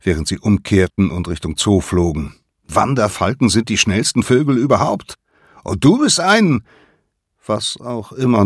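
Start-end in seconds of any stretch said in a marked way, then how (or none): 2.7-6.6 high, loud words against the wind; breathless boastful shout; shouting in flight. A narrator's reading. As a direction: yell over the rushing air. Nolan shouts while flying.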